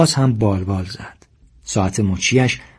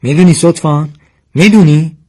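raised, higher, louder challenge; angry shout; 5 semitones higher, 7 dB louder than usual. A narrator's reading. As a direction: shout the question, louder on the repeat. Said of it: "و داد زد". (با داد و پرخاش)

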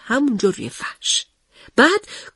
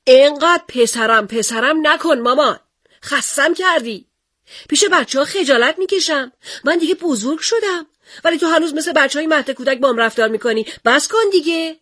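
louder complaint; 6 semitones higher than usual. Acted. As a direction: whine it at mum, louder and louder. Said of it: "با صدای بلندتری گفت".

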